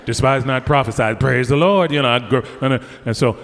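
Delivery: ordinary talk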